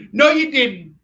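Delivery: loud, high comic retort; hammed-up denial; mock outburst. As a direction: fire it back high and loud. Acted comeback in retold encounter.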